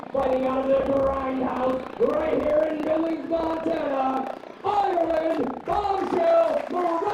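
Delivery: booming, drawn-out ring announcer call. Acted. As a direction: build to a roaring name call. Ring announcer introduces fighter.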